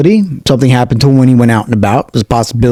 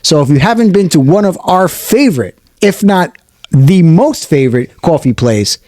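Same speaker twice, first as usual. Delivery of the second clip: higher, upbeat radio ad voice; ad read; 4 semitones higher than usual. Acted. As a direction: sell the cafe like a radio spot. Continues the sponsor read.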